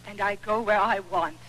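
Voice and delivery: ordinary delivery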